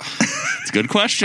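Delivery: a high repeat, broken by laughter; laughing. Real, not acted. To the co-hosts, high and laughing.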